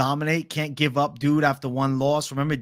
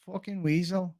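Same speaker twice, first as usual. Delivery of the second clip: higher, softer try at Irish; Irish accent; 5 semitones higher, 8 dB quieter than usual. Acted. Streamer practising Ian Garry's voice, tentative Irish accent. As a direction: test out an Irish accent under your breath. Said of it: "How do you even do an Irish accent?".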